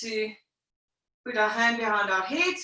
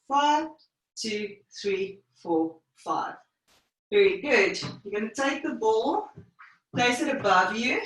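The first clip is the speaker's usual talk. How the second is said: usual speaking voice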